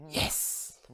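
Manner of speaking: unvoiced hissy whisper; secretive breath-only answer; whispering coin.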